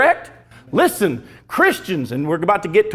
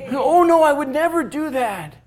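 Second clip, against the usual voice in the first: mock protest; 8 semitones higher than usual. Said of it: "daughter's protest".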